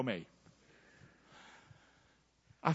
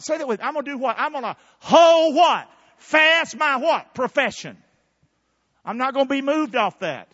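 higher-pitched, emphatic call-and-response sermon; fervent preaching; 7 semitones higher, 7 dB louder than usual. Real, not acted. Preacher declaring he holds fast, fervent preaching. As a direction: drive the congregation to answer.

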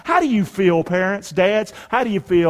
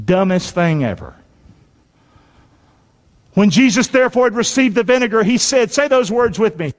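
ordinary talk, nothing marked

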